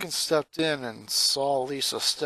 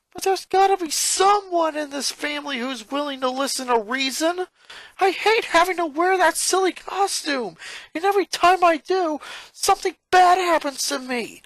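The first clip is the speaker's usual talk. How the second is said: put-on much higher, louder boy yelling; restless yell; 6 dB louder than usual. Lincoln complains to Lisa about the costume, yelling. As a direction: vent the frustration loudly. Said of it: "Lincoln yelled".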